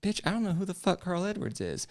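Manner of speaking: high-pitched, indignant comeback; exasperated retort. Retold or imitated. Acted exasperated reply to yelling lady.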